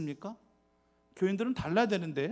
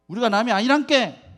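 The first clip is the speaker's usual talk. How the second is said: louder, higher Jeolla dialect slogan; 6 semitones higher, 7 dB louder than usual. Retold or imitated. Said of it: "전라도 지역에서 뭐래요". (크고 높게 외치는 전라도 사투리)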